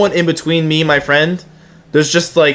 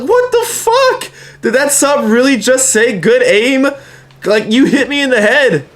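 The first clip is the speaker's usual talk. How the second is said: high, strained mock outrage; high-pitched disbelieving friend voice; imitating stunned Rob; 8 semitones higher than usual. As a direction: play Rob baffled and indignant. Rob reacts to the hit.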